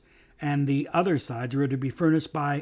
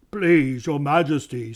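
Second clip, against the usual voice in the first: sleepy bear voice; 4 dB louder, slower than usual. Acted. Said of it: "a thick, sleepy voice".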